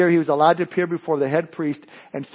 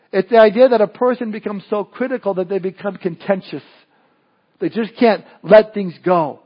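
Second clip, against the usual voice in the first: normal talking voice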